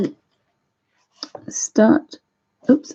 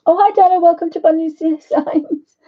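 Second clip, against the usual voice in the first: much higher, louder welcome; bright excited greeting; 9 semitones higher, 6 dB louder than usual. Real, not acted. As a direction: greet a friend who just arrived. Cheerful greeting to live viewer.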